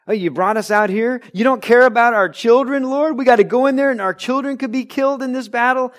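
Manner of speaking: high, loud and fast accusing whine at God; hammed-up Israelites wailing at the Lord; shrill-edged mock protest, rushing the last line; whiny complaint. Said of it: "they say".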